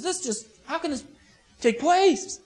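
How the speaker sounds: high-pitched, baffled protest; incredulous. Acted. [name] finds it not appropriate, incredulous. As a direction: object in halting disbelief. [name] acted as shocked objector.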